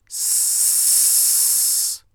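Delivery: long voiceless "س", a pure hiss of air; sustained s sound. Shown as a demonstration. Teacher has learners feel the air on their palm, long hissed s. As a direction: hold "س" as a steady hiss with no voice. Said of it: "با من این صداها رو تولید کنید".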